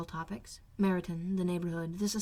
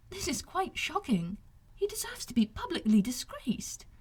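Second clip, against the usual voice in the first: shocked; 8 semitones higher than usual. Acted. Elizabeth shocked at Darcy.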